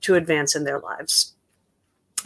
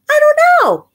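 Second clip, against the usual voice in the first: playful high squeal; 8 dB louder than usual. Acted. Playful answer to song's question.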